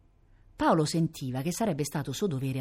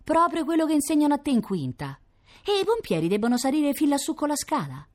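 put-on higher, teasing kid voice; bickering child; 9 semitones higher, 3 dB louder than usual. A narrator's reading. Brother and sister bickering over school grades, childlike teasing. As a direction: play a child sniping at a sibling.

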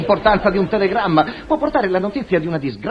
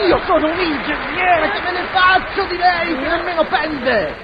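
high, outraged retort; indignant rejection; 7 semitones higher than usual. Acted. Engineer rejecting the smitten portiera, high and indignant. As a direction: fend off the suitor in high-pitched outrage.

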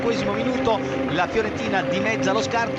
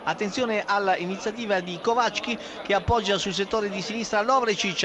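ordinary talk

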